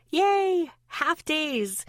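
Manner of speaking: high-pitched happy yay; excited cheer. A narrator's reading. Narrator cheers about half days.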